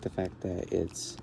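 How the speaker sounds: usual speaking voice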